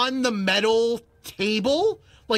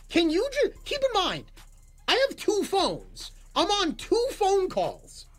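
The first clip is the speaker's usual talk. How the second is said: exasperated; 9 semitones higher than usual. Retold or imitated. Customer juggling two calls at the counter, exasperated. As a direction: plead in high disbelief. Retold exasperated plea, explains his situation.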